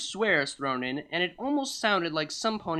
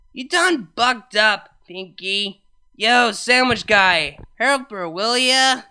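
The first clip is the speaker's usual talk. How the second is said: slow, louder tipsy calling; drunk Vinyl voice; 5 semitones higher, 8 dB louder, slower than usual. Acted. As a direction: drawl it out to the guy.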